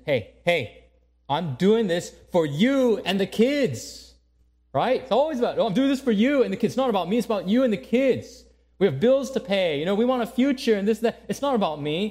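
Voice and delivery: husband snapping back that he does it for the family; offended husband. Acted. Husband defending himself to his wife, indignant and loud. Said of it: "the husband might say, feeling offended".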